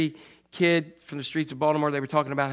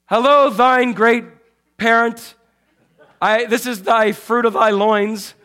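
put-on slow, louder, pompous old-fashioned address; mock formal prayer; 5 semitones higher, 8 dB louder, slower than usual. Mocking churchy prayer to a parent, slow and pompous. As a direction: ham it up like a stiff churchy caller. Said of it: "I don't usually say".

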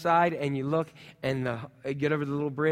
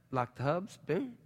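ordinary delivery

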